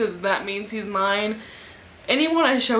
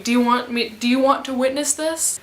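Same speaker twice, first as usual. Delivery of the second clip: ordinary delivery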